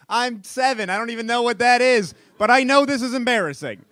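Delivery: put-on high, loud whiny kid voice; embarrassed kid. Boy embarrassed by dad in pharmacy, high whine. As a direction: squeak it like a mortified seven-year-old. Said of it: "And I'd be like".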